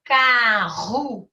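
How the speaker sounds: "Carruagem" slow, in four separate beats; "Carruagem" sounded out slowly with gaps between syllables. Shown as a demonstration. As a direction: break "Carruagem" into car, ru, a, gem. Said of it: "têm quatro ou mais sílabas".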